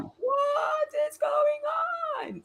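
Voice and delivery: high, slow mock shout; retold shout. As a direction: shout it out in disbelief. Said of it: "I'm like".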